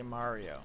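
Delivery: Mario with an open "ah"; Mario, first syllable like "mar"; forced other pronunciation. Shown as a demonstration. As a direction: make yourself say Mario as MAH-ree-oh. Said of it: "I couldn't force myself to say".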